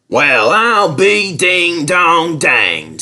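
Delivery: slow, drawn-out astonished exclamation; folksy guard. A narrator's reading. Guard staggers forward, amazed at the speed. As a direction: marvel at it like an old country guard.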